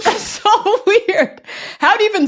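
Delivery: amused disbelief. Real, not acted. Host reacts to cousin's weird proposal, laughing in disbelief.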